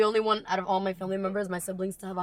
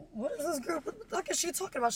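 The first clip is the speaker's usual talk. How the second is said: bewildered teacher imitation; 8 semitones higher, 4 dB quieter than usual. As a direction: imitate a teacher at a total loss. Acts bewildered, panicking teacher.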